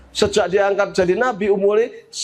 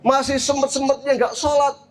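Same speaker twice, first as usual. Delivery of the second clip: higher-pitched, incredulous listing; acted disbelief in a raised voice; shocked mother; 6 semitones higher than usual. Mother learns people still skip worship, incredulous. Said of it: "Maka ibu ini kaget".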